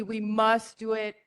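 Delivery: usual speaking voice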